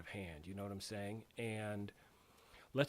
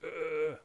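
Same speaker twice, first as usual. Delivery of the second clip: disgusted groan; 4 semitones higher, 6 dB louder than usual. Retold or imitated. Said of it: "I was like".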